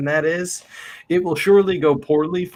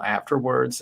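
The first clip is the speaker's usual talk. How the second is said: normal talking voice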